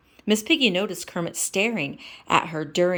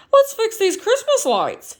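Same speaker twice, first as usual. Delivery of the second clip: Kermit voice; 8 dB louder than usual. Acted.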